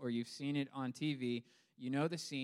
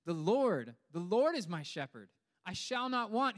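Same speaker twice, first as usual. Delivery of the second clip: loud, rising proclamation of the Lord; preacher voicing David's bold declaration; bold proud declaration; 7 semitones higher, 7 dB louder than usual. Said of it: "boldly and proudly declaring".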